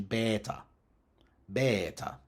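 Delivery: beta as BEH-tah, long open e; reconstructed ancient Greek. Shown as a demonstration. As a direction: hold an open "eh" in beta, no glide. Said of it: "the reconstructed ancient Greek pronunciation".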